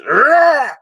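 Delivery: high barked roar like a linebacker; mock football hit roar. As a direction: roar it off the snap. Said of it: "I'm used to".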